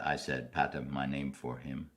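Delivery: ordinary delivery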